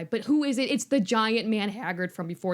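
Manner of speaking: usual speaking voice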